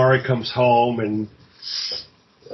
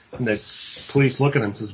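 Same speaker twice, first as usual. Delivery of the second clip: normal talking voice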